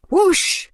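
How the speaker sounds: a sudden loud gust; whoosh. Acted. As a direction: sweep it like a gust. Wind sound effect.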